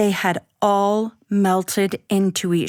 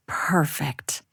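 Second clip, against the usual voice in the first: breathy whispered word; 6 semitones lower, 6 dB quieter than usual. A narrator's reading. Narrator marveling at the dough, whispered. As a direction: whisper it in awe.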